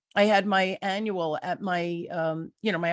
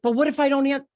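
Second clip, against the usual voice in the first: higher, louder mock panic; panicky protest; 6 semitones higher, 6 dB louder, faster than usual. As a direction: protest anxiously, imitating your worried self. Retells own panicky protest to husband.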